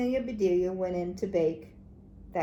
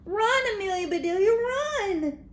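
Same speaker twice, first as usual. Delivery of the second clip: much higher, fast cheering yell; excited yell; 11 semitones higher, 3 dB louder than usual. A narrator's reading. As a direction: scream her on to run. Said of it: "yelled the boys".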